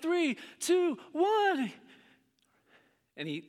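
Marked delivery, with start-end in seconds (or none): 0.0-1.7 higher, rising countdown to the buzzer; excited countdown. Pretend. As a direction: count down the final seconds. Countdown in pretend game.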